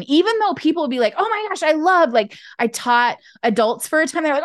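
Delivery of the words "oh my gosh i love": higher-pitched imitation of gushing admirers; gushing praise. Voicing admiring people's praise.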